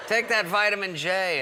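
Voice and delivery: high, loud confident instruction; pushy vitamin peddler. Retold or imitated. Rob ordering sick Adam, mock-insistent. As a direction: prescribe it forcefully. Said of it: "And so he goes".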